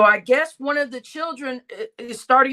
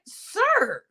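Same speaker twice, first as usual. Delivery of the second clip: high squeaky imitation; mock high "Sir"; 9 semitones higher than usual. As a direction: squeak it out mockingly. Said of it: "It's like".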